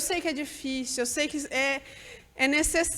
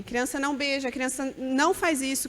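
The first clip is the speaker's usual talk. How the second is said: ordinary talk, nothing marked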